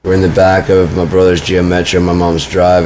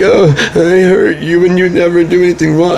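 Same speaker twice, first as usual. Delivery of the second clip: high, whiny mock sobbing; fake crying; 12 semitones higher, 3 dB louder than usual. Mocking women who make it about themselves, fake crying. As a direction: wail it in a mocking high-pitched weepy voice. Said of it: "they start this fake crying".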